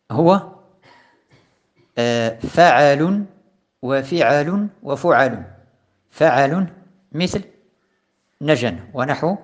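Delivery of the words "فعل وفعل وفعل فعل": each pattern فعل drawn out slowly for students; slow recitation. Shown as a demonstration. Teacher reciting the patterns to students, slowly. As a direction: spell out فعل وفعل وفعل one by one, unhurried. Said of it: "teacher recites the patterns".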